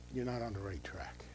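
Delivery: soft whispered warning. Real, not acted. Pastor warning believers, hushed whisper. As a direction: drop to a whisper for effect.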